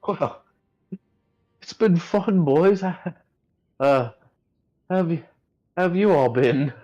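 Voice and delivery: put-on high, loud old man character voice; Edmund's voice. DM voicing Edmund greeting the party, high and loud. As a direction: pitch it way up as a jolly old adventurer. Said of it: "He goes".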